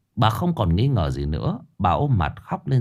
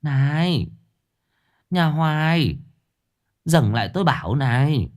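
high, calling after someone down the road; old neighbour stopping Hoài in the street, calling out; old woman calling out; 6 semitones higher than usual. A narrator's reading. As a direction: hail her from a distance. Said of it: "bà cụ Lơn gọi với".

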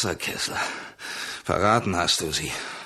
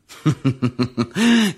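a short cold mocking laugh; scornful laugh; 7 semitones higher, 5 dB louder than usual. Betrayed hunter laughs at the comrade who tried to kill him, scornful.

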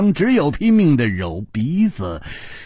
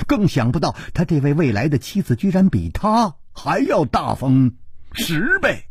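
usual speaking voice

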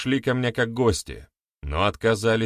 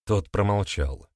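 ordinary delivery

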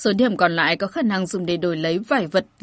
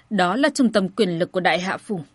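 ordinary delivery

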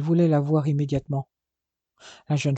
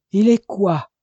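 higher and louder, a shocked scream; 4 semitones higher, 6 dB louder, slower than usual. Acted. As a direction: scream the question at him. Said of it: "hurla-t-elle".

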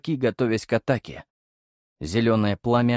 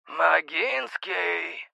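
very slow, drawn-out metallic insistence; insistent metallic voice; 7 semitones higher, 3 dB quieter, much slower than usual. A narrator's reading. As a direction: call the name again, pressing harder. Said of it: "произнесли настойчивее".

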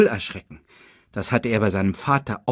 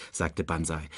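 plain reading voice

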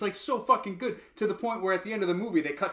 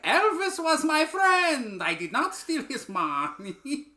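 put-on much higher, slow odd accent; Tom Hanks's Parker voice; 11 semitones higher, 4 dB louder, slower than usual. Imitating Tom Hanks's Parker at home, high and slow. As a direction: stretch it out in a strange put-on drawl. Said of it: "going around the house".

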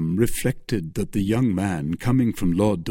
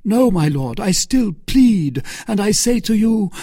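urgent pleading; 10 semitones higher, 5 dB louder than usual. Acted. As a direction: beg with rising force.

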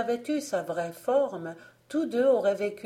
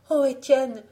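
high, loud exclamation of worry; anxious sister crying out; alarmed cry; 6 semitones higher, 7 dB louder than usual. A narrator's reading. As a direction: call out to stop him. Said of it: "s'écria-t-elle".

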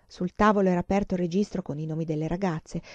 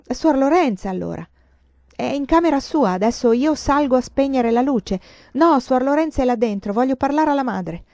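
raised voices, higher and louder; heated exchange; 6 semitones higher, 6 dB louder than usual. Acted. Girl and nun clash at the door, loudly. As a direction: push back hard. Silvia and nun argue at night.

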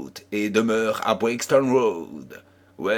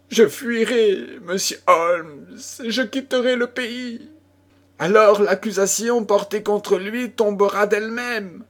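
panicked plea, pitched higher; frightened thief pleading; 9 semitones higher than usual. A narrator's reading. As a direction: voice of a cornered man pleading to run away. Ryder pleading to flee.

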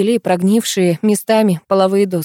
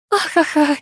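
burst of laughter; 8 semitones higher than usual. A narrator's reading. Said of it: "разразилась я".

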